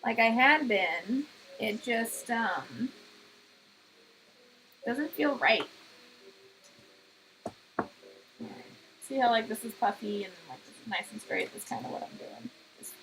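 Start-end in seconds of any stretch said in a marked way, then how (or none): 0.0-10.2 muffled speech, pin between lips; pin in mouth. Real, not acted. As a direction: speak around a sewing pin. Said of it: "got a pin in my mouth now".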